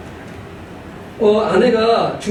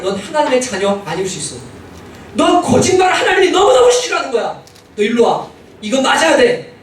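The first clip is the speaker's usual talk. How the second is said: angry scolding; 7 semitones higher than usual. Retold or imitated. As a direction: tell him off before the spanking. Father scolds lying son.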